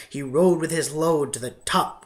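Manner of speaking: plain reading voice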